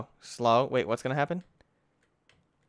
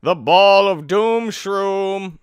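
slow, loud, raised announcement; mock-dramatic announcer; 6 semitones higher, 10 dB louder, much slower than usual. Player naming a strange item, mock-dramatic. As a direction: boom it out grandly, dragging the words.

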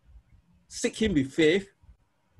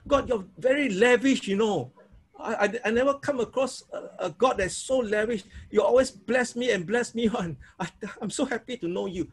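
higher, delighted praise with a laugh; joyful prayer; 4 semitones higher than usual. Shown as a demonstration. Pastor modelling praise to students, higher joyful prayer with a laugh. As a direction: gush thanks to a lavish God. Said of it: "You always talk like that".

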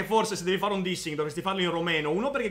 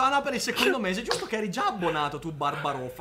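normal talking voice

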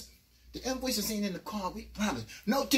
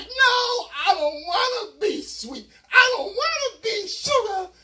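much higher, loud, slow; panicked plea; 12 semitones higher, 8 dB louder, slower than usual. Retold or imitated. Narrator begging the guards. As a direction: wail it in terror. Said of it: "I was like".

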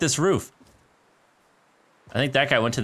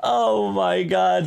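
high, slow, drawn-out complaint; whiny lament; 12 semitones higher, much slower than usual. Real, not acted. Streamer wailing to viewers about the miss, drawn-out whine. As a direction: stretch the words out in disbelief.

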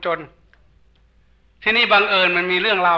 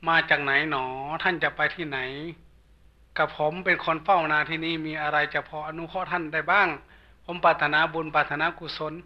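soft, low, respectful plea; humble devotee; 3 semitones lower, 8 dB quieter than usual. Acted. Field watcher offers help to a passing monk, soft humble respect. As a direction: ask reverently, in a lowered voice. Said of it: "คนนั้นนั่งพนมมือถาม".

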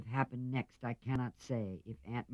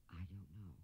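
barely audible, much softer; a faint, bashful answer; very soft reply; 3 semitones lower, 18 dB quieter than usual. A narrator's reading. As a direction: murmur it shyly, almost inaudible. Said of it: "so softly that John had to stoop".

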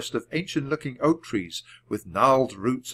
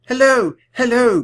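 high, loud, airy greeting of the queen; queen's greeting; 12 semitones higher, 10 dB louder than usual. A narrator's reading. Guinevere hurrying up to greet Jack and Annie, high and loud. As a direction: greet the children with delight.

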